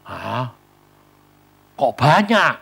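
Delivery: surprised. Retold or imitated. Retold shock at large sum.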